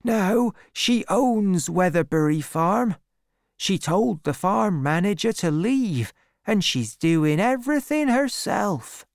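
high-pitched market farmer; farmer gossiping. A narrator's reading. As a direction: explain it as a local gossip.

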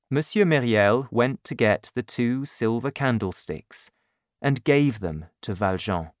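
ordinary delivery, nothing marked

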